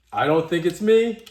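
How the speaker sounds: loud, high insistence; loud protest. Real, not acted. Vlogger insisting to viewers, loud and high. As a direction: push back hard.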